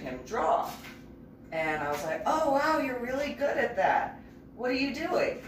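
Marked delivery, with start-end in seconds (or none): 2.3-5.4 put-on higher, bright childlike wonder; young [name]. Little [name] watching Ian draw, high amazed kid voice. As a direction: lift the voice like an amazed little girl.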